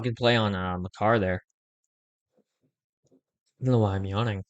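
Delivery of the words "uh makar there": usual speaking voice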